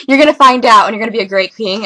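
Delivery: loud, laughing through the words; laughing while reading notes aloud. Real, not acted. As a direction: giggle it out loud. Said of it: "laughs while reading".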